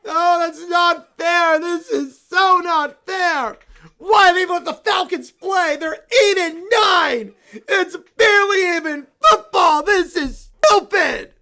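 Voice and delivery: whiny tantrum. Acted. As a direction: stomp through the complaints like a sore loser. Bear toy rages over football loss.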